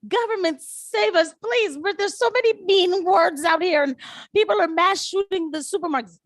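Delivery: high pleading mock of naive voters; mocking high whiny voice. Mocking naive voters begging the government, high and whiny. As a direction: whine it up high like a helpless citizen begging. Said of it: "y'all was like".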